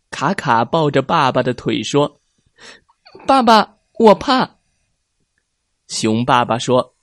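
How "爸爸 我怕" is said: put-on high, loud frightened child voice; a small cub clinging to dad, scared; scared little cub. Little cub Kaka begs his father in the storm, high and frightened.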